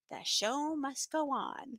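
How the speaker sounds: soft, slow, drawn-out declaration; mock-brave aside. Teacher with both kids in class, hushed mock resolve. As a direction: say it slowly under your breath, theatrically. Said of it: "I'm like".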